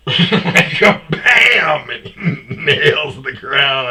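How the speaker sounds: loud, drawn-out words through laughter; laughing while telling. Real, not acted. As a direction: crack up on the impact. Laughs telling buddy's fall.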